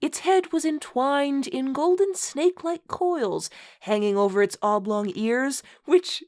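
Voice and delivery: mock-grand read-aloud, stifling laughter; story narration. Host reading a bad fanfic to co-host, mock-solemn narration. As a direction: read the purple prose with a straight face.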